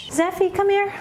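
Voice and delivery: high, sweet pet-talk voice; cooing to the cat. Real, not acted. Calling to her cat.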